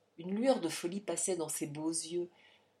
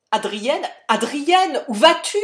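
rising frantic shout, louder each time; a desperate father calling his fleeing daughter; panicked cry; 8 semitones higher, 14 dB louder than usual. A narrator's reading. Father crying out to his leaving daughter, frantic shout. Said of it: "s'écria le misérable affolé".

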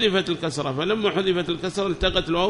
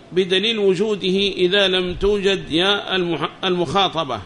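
higher, louder recitation of a book; reading text aloud; 4 semitones higher, 5 dB louder than usual. Acted. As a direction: read the text out to the class, raised and measured. (قراءة المتن بصوت مرتفع)

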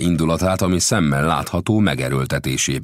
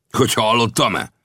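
crying out; 9 semitones higher, 4 dB louder than usual. A narrator's reading. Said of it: "kiáltott fel Lang".

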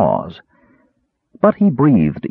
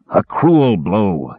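muttered; much slower than usual. A narrator's reading. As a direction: drag the words out, wincing in pain. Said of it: "he muttered".

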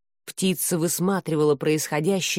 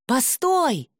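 calling out; 6 semitones higher than usual. A narrator's reading. Calls after fleeing figure.

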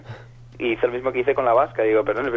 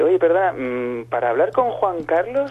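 higher, slow put-on woman's voice; female prank caller; 5 semitones higher, slower than usual. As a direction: play the caller asking for the king. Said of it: "llama un día una tía". (imitando a la mujer que llama preguntando por Juan Carlos)

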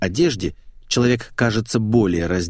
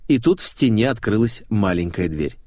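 ordinary delivery

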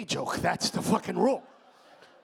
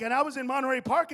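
usual speaking voice

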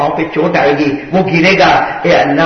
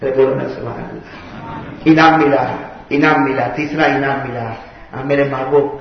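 ordinary talk

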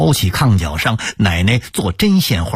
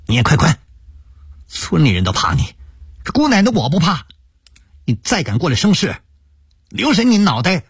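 sharp, fierce scolding; stern; 8 semitones higher, faster than usual. A narrator's reading. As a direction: drive him out harshly. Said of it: "厉声说".

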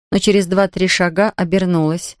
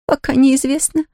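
sobbing; 8 semitones higher than usual. A narrator's reading. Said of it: "всхлипнула Нэнси".

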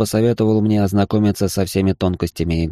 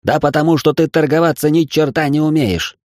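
high-pitched, exasperated mock yell; contained narrator's version of an angry bellow; 9 semitones higher, 3 dB louder than usual. A narrator's reading. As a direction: scold in a raised, high voice. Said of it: "заорал он так, что у меня заныли барабанные перепонки".

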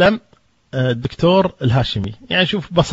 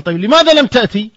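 mock demand, higher and louder; demanding retold question; 8 semitones higher, 5 dB louder than usual. Host confronts the guest who skipped dinner, mock anger. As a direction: press him hard for an answer, lightly acted.

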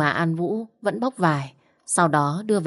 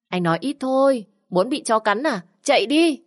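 much higher, urgent cry to run; urgent shout; 7 semitones higher than usual. A narrator's reading. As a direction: yell at him to run, now. Fleeing a dog, urging him. (giục anh chạy, hét to)

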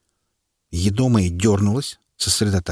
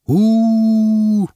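high, louder drawn-out booing howl; crowd howl; 13 semitones higher, 6 dB louder than usual. A narrator's reading. Crowd howling at the harsh sentence, booing. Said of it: "взвыла толпа".

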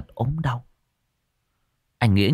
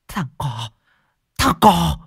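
hissed start building to a high, loud shriek; crazed mother shrieking curses while running; shrieking rage; 7 dB louder, slower than usual. Acted. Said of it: "vừa chạy vừa rít lên". (rít lên điên dại, từ nhỏ vọt thành thét cao và to)

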